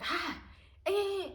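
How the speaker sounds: high, loud gasping 'ah, eh'; mock shocked outburst. Showing how they can't react on the spot, mock shock. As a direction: act out an exaggerated shocked reaction. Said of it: "I can't just be like".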